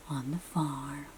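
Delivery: fading sleepy whisper. Acted. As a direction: whisper it down so nobody wakes. Animals snoozing, whispered reading.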